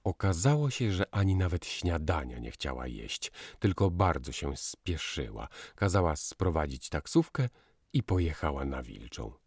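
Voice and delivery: plain reading voice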